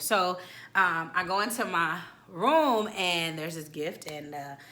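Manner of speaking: ordinary talk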